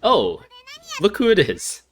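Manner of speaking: high, loud, swooping fake surprise; mock surprise. Player announcing their own arrival in the story, mock surprise. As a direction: greet them with hammed-up delight.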